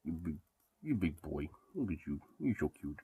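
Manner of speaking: soft pet talk. Real, not acted. Owner murmuring to her big dog, hushed and tender.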